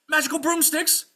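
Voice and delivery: high, loud shocked exclamation; alarmed squire. Acted. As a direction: cry it out in astonishment.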